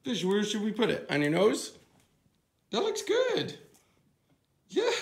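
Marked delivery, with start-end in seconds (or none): 2.7-4.9 pet voice. Real, not acted. Talking to the dog.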